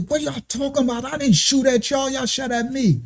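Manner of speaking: high-pitched, softened retelling of a protest; mock indignant protest. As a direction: act out pleading innocence, pitch jumping up. Acting out his protest to the cops.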